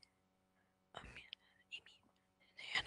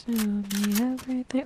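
ordinary talk, nothing marked

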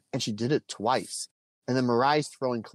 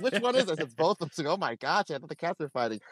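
amused retelling; 5 semitones higher, faster than usual. Retold or imitated. Laughing retelling of wife's questions.

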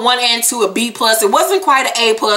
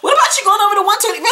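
high, rapid mock protest; squeaky-high retold nagging; mock upset; 12 semitones higher, much faster than usual. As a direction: imitate her complaining in a high rushed voice. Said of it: "She get mad".

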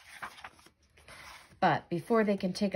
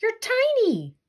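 high, loud, disbelieving; can't believe what he sees; shocked disbelief; 12 semitones higher, 7 dB louder than usual. Real, not acted. Friend sees Narwhal shrunk, high loud shock.

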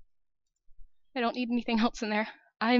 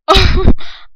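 loud shaky burst of laughter; relieved loud nervous laugh; 6 semitones higher, 19 dB louder than usual. Real, not acted. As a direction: laugh out the fear. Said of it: "so many weird sounds".

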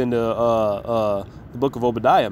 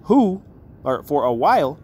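slow, solemn reading of the verse; measured King James recitation; scripture reading; 4 semitones higher, slower than usual. Acted. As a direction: read it out like holy writ, slowly. Reads scripture aloud.

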